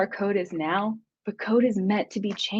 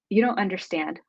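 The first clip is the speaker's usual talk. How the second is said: ordinary talk, nothing marked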